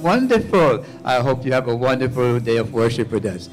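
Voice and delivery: ordinary talk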